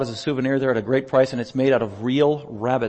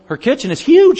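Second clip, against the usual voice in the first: mock exaggeration; 11 semitones higher, 8 dB louder than usual. Real, not acted. Teasing about small kitchen.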